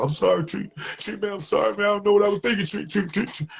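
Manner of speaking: high, whiny begging apology; pleading friend. Retold or imitated. Friend in the car begging Tree, high pleading whine.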